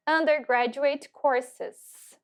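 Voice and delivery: very slow "Undergraduate courses"; slow careful teacher model; model pronunciation. Shown as a demonstration. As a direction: stretch each syllable of "Undergraduate courses" for repetition. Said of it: "Vão repetindo aí".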